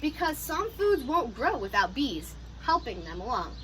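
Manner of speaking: ordinary talk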